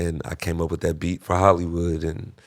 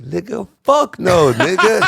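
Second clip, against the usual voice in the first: high, loud, slow flat-out no; loud refusal; 12 semitones higher, 7 dB louder, slower than usual. Retold or imitated. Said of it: "he's like".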